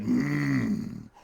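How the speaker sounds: slow, drawn-out menacing bear; big storybook bear, very slow; hungry bear. Acted. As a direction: savour each word like a hungry bear.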